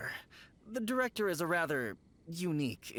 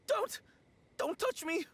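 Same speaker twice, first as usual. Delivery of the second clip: high, panicked warding-off; high-pitched, jumpy terror; frightened plea; 14 semitones higher than usual. Acted. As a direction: plead in fright to be left alone. Frightened man backs away from them.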